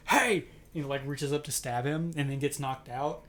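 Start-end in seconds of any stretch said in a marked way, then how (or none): 0.1-0.4 loud, high retold shout; mock yell. Husband charging the intruder with a knife, mock yell.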